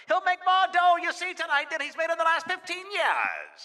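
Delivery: excited vintage boxing announcer, ringside at the Garden; 1920s broadcaster. Acted. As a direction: call the fight like a 1920s radio man. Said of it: "Let's do that one again".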